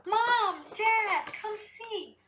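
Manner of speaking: high, eager call to the parents; a little girl calling out with excitement; excited child call. Acted. Girl calls her parents to the garden, high and excited.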